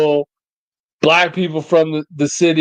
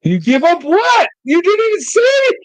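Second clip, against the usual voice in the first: much higher, half-shouted protest; incredulous outburst; 13 semitones higher than usual. Real, not acted. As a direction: throw up your hands in disbelief. Baffled by friend's cryptic answer.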